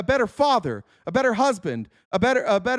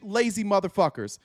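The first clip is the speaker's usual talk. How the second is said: normal talking voice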